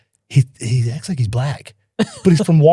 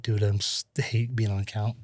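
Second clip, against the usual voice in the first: muttering to himself; 4 semitones lower, 12 dB quieter than usual. Retold or imitated. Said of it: "Every day I was like".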